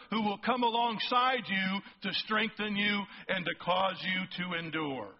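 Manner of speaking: fervent pulpit reading with stretched key words; preaching. Acted. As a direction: preach it out to the congregation, full force. Preaching a passage at full force.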